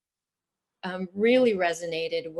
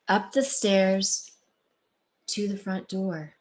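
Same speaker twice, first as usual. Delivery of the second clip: expressive read-aloud storytelling; story narration; 3 dB louder than usual. A narrator's reading. Author reading her book to the audience, expressive. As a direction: tell it like reading to a class.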